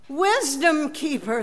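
crying out. Acted. Followers waking their teacher in the storm, crying out. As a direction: call to him in desperation. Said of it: "they cried out".

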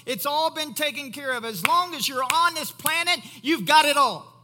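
loud, high, building sermon delivery; full-force preaching. Real, not acted. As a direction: drive it home, rising to a shout.

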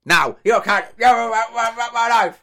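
high, loud ranting caricature; Eli voice. Acted. Mocking a friend who never listens, in a loud high put-on voice. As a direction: burst into a loud, repetitive high-pitched rant. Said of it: "[name]'s Eli voice".

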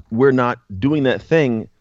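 ordinary talk, nothing marked

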